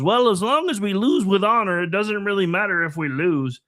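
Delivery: high-pitched mock liberal voice; put-on high voice of a silly liberal; stupid liberal, Kermit-like. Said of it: "supposed to sound like stupid liberal".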